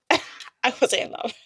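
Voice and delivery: slow, laughing denial. Real, not acted. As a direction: giggle through the denial.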